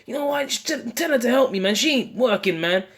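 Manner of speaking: high, fed-up whine; high mock nagging; griping Martha. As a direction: complain like the busy sister. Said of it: "she was bitching about Yahweh".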